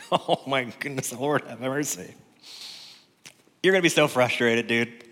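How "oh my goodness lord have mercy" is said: laughing, exasperated plea; mock exasperation. Preacher joking to congregation about getting frustrated, mock exasperated. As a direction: throw up a playful cry for mercy.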